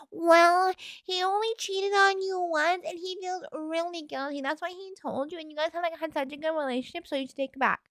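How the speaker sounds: high whiny mocking voice. Mocking the friend's advice.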